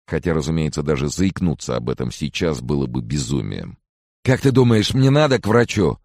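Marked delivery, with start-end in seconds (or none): 4.2-6.0 high, loud anxious plea; worried, raised voice; anxious question. A narrator's reading. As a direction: ask urgently, voice pitched up. Frightened friend asks for advice.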